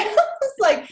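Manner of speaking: bursts into a high laugh mid-sentence. Real, not acted. Guest laughing with the host about surviving it.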